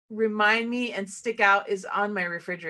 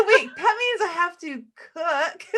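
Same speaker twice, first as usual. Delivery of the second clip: laughing; 6 semitones higher, 5 dB louder than usual. Real, not acted. Realizing she must cook.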